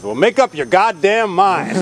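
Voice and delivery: exasperated grandfather. Retold or imitated. Grandfather to indecisive driver, mock exasperation.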